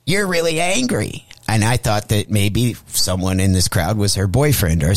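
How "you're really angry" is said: imitated possessed girl, pitched high; put-on high, eerie mock-demon voice; switched devilish voice. Replaying the biting girl's words, weird high devilish imitation. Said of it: "when she was like".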